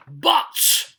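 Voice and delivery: a sudden roaring But that flips the mood; sudden loud shift. Acted. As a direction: switch the tone hard on this one word. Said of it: "[name] changes the tone completely right on that word".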